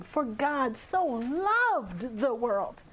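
slow, with "loved" stretched out and swooping up in pitch; stress on "loved". Shown as a demonstration. Speaker showing how emphasis brings meaning, "loved" drawn out and stressed. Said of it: "The way you stress words or emphasize words".

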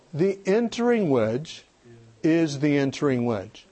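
slow, deliberate phrase for repeating; measured, spaced-out teaching line; call-and-repeat slogan. Shown as a demonstration. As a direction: lead the room through it slowly. Leading audience to repeat a phrase.

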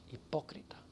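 soft hissed whisper of disgust; preacher whispering the damning word; whispered condemnation. Real, not acted. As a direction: drop to a whisper for the verdict.

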